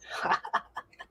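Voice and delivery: breathy chuckling; acted mobster laugh. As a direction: laugh along to ease the tension. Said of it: "the other one says".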